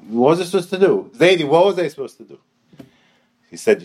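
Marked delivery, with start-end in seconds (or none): 0.1-2.4 higher, louder plea to grandpa; acted protest, pitched up and pushed out; exasperated protest. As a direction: plead your innocence, high and loud.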